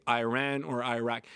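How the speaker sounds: "eye-RAN or eye-RACK" for Iran or Iraq; Iran and Iraq each starting like iPod; pronounced with I. Shown as a demonstration. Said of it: "not an I like iPod".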